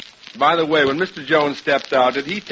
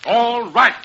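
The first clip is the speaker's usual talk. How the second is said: higher-pitched, grudging giving-in; exasperated; 7 semitones higher than usual. Acted. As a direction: give in with exasperation. Gives in crossly during money quarrel.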